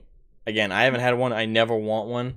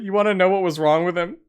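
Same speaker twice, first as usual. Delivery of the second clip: teasing sister; 7 semitones higher, 4 dB louder, faster than usual. Retold or imitated.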